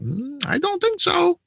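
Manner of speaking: very high, slow mock cashier; sceptical refusal. Cashier doubting customer's seventy-five dollar claim, sceptical.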